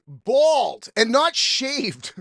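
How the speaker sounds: ordinary talk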